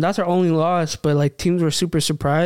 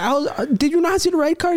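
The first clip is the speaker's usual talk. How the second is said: incredulous; 10 semitones higher, faster than usual. Retold or imitated. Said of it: "I was like".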